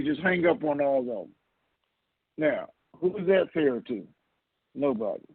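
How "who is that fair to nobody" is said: ordinary talk, nothing marked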